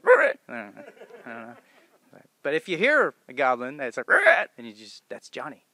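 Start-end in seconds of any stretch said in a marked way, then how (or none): 0.0-0.4 short, much higher, much louder goblin trill; goblin noise. Retold or imitated. Imitates his goblin noise. 4.1-4.5 short, much higher, much louder goblin trill; goblin noise. Retold or imitated. Imitates his goblin noise.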